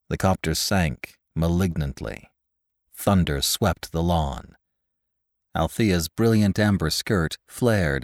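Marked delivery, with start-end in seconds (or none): none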